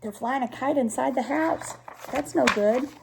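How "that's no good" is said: ordinary delivery, nothing marked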